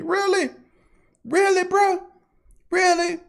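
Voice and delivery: high, soft disbelief; really, bro, are you serious; high incredulous scoff. Real, not acted. Incredulous at critics.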